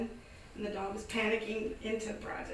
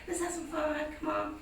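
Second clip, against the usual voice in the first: playful coaxing; 7 semitones higher, 3 dB louder than usual. Retold or imitated. Coaxing the panicked pug.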